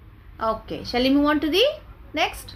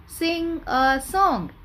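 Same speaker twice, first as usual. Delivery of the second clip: slow, drawn-out, a bit higher; slow model phrase; 4 semitones higher, slower than usual. Shown as a demonstration. Teacher slowly models the phrase for children. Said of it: "repeat with me, children".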